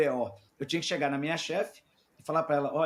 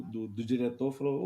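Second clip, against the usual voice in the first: ordinary talk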